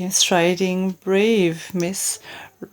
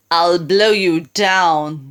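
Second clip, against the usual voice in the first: wolf threat; 5 semitones lower, slower than usual. Acted. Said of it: "the wolf replied".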